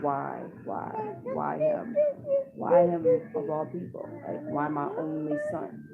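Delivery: anguished pleading, retold through tears; retold crying. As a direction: plead as if asking why all night. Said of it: "I'm just crying the whole night".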